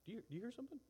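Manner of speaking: soft hush then a high puzzled question; mock hushed curiosity. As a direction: shush and strain to listen. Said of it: "he's like".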